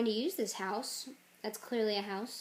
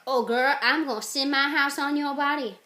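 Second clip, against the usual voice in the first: loud, higher, hammed-up comeback; sassy threat; 4 semitones higher, 9 dB louder than usual. Adult sending his house on the girl, loud sassy threat. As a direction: threaten her loudly with mock sass. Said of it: "But he's like".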